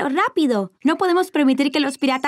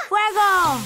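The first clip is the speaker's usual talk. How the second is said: higher, excited call to fire; play battle command; 4 semitones higher than usual. Pretend. Child captain orders sibling to fire, excited call.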